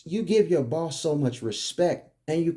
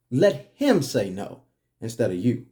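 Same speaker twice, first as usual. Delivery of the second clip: ordinary talk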